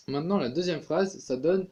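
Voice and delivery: ordinary delivery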